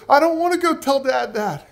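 put-on very high, loud child's wail; imitated whimpering boy. Little boy refusing his mother, mock crying in a high voice. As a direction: wail it like an upset little kid. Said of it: "started to kind of cry and whimper".

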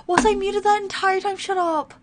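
high, whiny incredulous question; dismayed disbelief. Real, not acted. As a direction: cry out in high-pitched disbelief. Dismay at having been muted.

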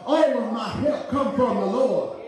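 high, ringing sermon climax over music; whooping preacher voice; preaching at full force. Acted. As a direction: lift it to a shouted church climax.

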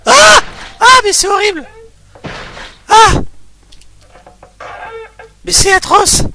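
panicked shrieks, high and loud; scared screaming. Real, not acted. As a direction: scream in real fright. Horror game jump scare.

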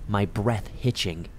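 usual speaking voice

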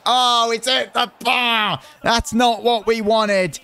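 high, loud, slow groan at the miss; anguished shout. Real, not acted. Gamer seeing his striker hit the bar, slow anguished shout. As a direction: cry out as the ball hits the bar.

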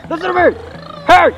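shouting sheriff. Acted. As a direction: shout after the speeding car. Sheriff yells at speeder.

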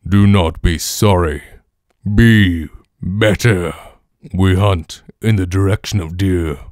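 slow, loud, low commanding voice; game character voice. Acted. Game character instructing a boy on the hunt, slow booming voice. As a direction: order it slowly and heavily, stretching each word.

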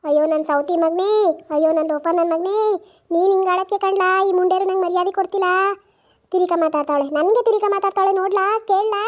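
wailing grandmother complaint. Acted. Grandmother wailing to her son about disrespect, loud complaint. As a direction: cry out to him that they insult her.

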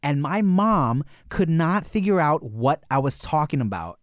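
normal talking voice

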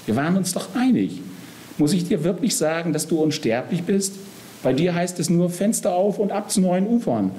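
high-pitched exasperated appeal; remonstrate, pitch climbing; agitated. A narrator's reading. Death arguing with the disloyal Soul, agitated. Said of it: "ruft der Tod aufgebracht".

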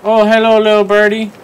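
high, loud sweet greeting; coaxing a wild bird. Real, not acted.

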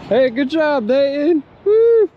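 loud high cheering; excited cheer. Real, not acted. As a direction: cheer him on loudly. Cheering on Dayton's catch.

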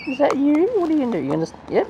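high-pitched pet talk; high, questioning bird-owner chatter. Real, not acted. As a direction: coo up high to a little bird.

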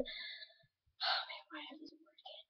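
barely audible whisper to self; whispered aside. Real, not acted. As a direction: whisper it to yourself, stuck on a word. Aside while stuck on a word.

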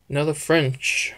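usual speaking voice

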